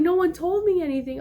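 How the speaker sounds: high, plaintive mock protest; retold crying. Child pleading ignorance to mom, mock crying. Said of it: "started profusely crying 'cause I was like".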